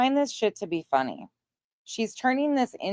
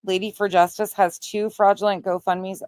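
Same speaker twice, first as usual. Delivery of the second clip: ordinary talk, nothing marked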